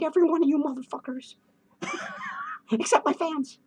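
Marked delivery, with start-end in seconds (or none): 1.8-2.7 high clown giggle. Acted. Clown cackle.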